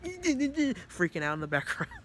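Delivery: words broken by laughter; laughing. Real, not acted. Describing Marigi's panic attack, laughing. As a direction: giggle through it.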